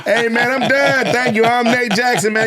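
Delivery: high, laughing comic outro; stage sign-off. Retold or imitated. Comic replaying his laughing stage sign-off, pitched high. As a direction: wave off the crowd mid-laugh. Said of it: "Literally how I left".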